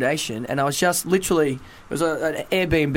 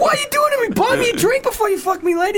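very high-pitched indignant squeal; mock outrage. Guest complains to the lady who charged him, mock outrage. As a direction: protest in a comic, far higher voice. Acted protest at the booking host.

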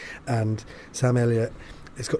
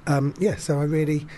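usual speaking voice

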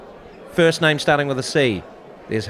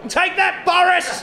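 triumphant shout. Pretend. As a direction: yell it like a victory taunt. Mock triumphant jab at Boris.

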